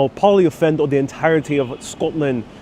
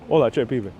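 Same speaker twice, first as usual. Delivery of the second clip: lower, softer Puerto Rican Spanish; Puerto Rican accent; 4 semitones lower, 4 dB quieter than usual. Acted. Streamer redoing the greeting, Puerto Rican accent. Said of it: "I'm not saying that very Puerto Rican".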